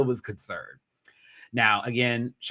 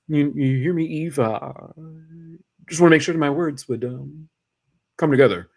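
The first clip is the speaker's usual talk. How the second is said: Obama impression; 5 semitones higher, 5 dB louder than usual. Drag race contestant impersonating Obama for the judges, halting and measured. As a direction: do Obama, drawing out each phrase. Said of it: "that's the most I can do for Obama".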